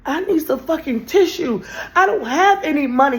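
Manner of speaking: crying plea. Retold or imitated. Retold plea, crying for tissue.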